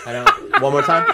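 laughing high while begging. Real, not acted. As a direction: plead through laughter.

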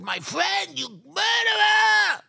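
high, loud accusing scream, last word drawn out; outraged scream. Retold or imitated. Survivor rejecting being called friend, outraged scream. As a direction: throw it back at him screaming. Acted furious reply to Epstein.

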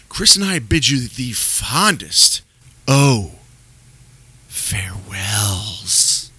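slow, drawn-out farewells; dramatic sign-off. Acted. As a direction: stretch farewells like a horror host. Spooky show sign-off.

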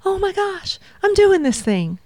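joyful realization. Retold or imitated. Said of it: "my heart got really big on that one".

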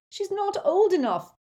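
high, loud scolding; mother shouting. A narrator's reading. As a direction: scold the twins at full voice. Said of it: "shouted Missus Weasley".

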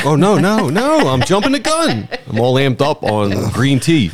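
ordinary talk

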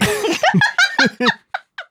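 squeaky burst of laughter; high squealing laugh. Real, not acted. Laughs at own joke.

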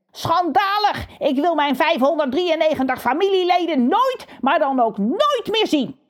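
high, indignant crow voice; outraged crow boss. Acted. Kakka disowning his whole family, high and indignant. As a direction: spit it out in indignation.

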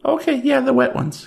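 high chirpy desk clerk; Tracy voice. Acted. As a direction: pitch way up for the clerk.

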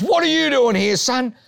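high, loud mock principal demand; stern principal. Principal confronting his former student, mock stern. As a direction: play the old principal cornering him.

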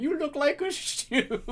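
very high, slow, drawn-out mock wail; comic high-pitched tearful squeak; mock blubbing. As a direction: imitate someone dying and trying not to blub. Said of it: "trying not to fucking blub everywhere".

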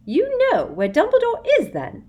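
high, loud eager question. A narrator's reading. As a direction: pounce on it greedily. Umbridge eager.

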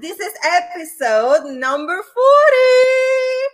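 big, high, loud show-host welcome; hyped host greeting the listeners; excited opening. Real, not acted. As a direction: launch the episode with a burst of energy. Energetic podcast show opening.

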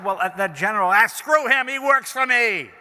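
put-on swagger like a drunk boss; drunk Shultz. Drunk secretary mocking the president, loud tipsy swagger. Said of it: "And I said".

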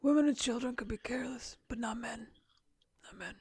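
hoarse whispered Brando impression. Host redoes the line as a whispered Brando. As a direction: rasp it like the Godfather. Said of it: "Is that Brando?".

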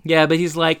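ordinary delivery

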